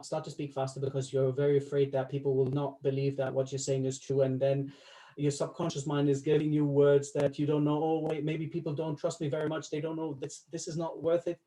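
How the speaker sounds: hurried run-on delivery, words tumbling together; rushed, unconfident speech. Shown as a demonstration. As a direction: race on without pauses, as if afraid nobody believes you. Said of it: "I was doing it on purpose".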